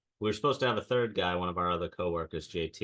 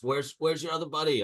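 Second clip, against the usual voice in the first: mild mock yell, higher pitch; higher-pitched imitation of a demanding shout; retold yelling; 8 semitones higher than usual. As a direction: imitate someone yelling at you, without full volume. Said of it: "starts yelling at us".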